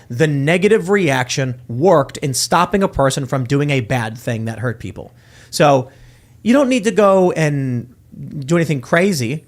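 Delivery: ordinary talk, nothing marked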